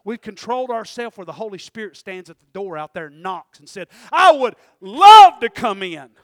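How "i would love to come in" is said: pleading call. Acted. Holy Spirit voiced at the door.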